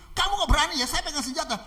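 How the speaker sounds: high, loud indignant challenge; angry robber. Acted. Armed robber challenging the daring pastor, angry. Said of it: "Marah-marah ini si perampoknya".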